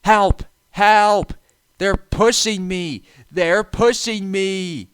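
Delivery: high, loud, desperate cries for help; panicked shout. A narrator's reading. Runaway engine pushed downhill, screaming in panic. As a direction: scream it as you lose control. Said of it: "he shouted".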